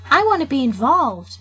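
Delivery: a light put-on silly voice; weird voice. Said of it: "without the weird voice".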